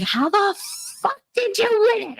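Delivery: high, squeaky whiny ex; mocking ex-husband. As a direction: mimic a sore loser's outraged squeal. Mocking ex-husband losing at games.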